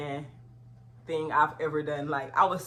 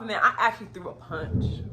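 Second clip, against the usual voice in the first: normal talking voice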